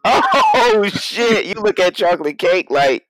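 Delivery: high, loud, drawn-out outburst; shocked amusement. Real, not acted. Co-host reacting to a cake comparison, shocked and amused. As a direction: blurt it out, scandalized and amused.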